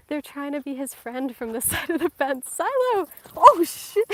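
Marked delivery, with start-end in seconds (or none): 2.5-3.1 high, loud shout to the dog; calling out. Real, not acted. 3.3-4.0 loud, high yelp of surprise; startled exclamation. Real, not acted. Startled by the dogs.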